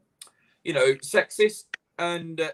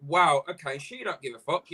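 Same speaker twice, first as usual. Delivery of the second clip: ordinary talk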